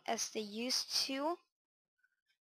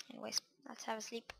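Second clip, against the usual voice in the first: whispering; 8 dB quieter, faster than usual. Real, not acted. Whispered aside, going to bed.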